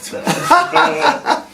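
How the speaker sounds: a high burst of real laughter. Real, not acted. Quizmaster cracking up at a contestant's quip, high laugh.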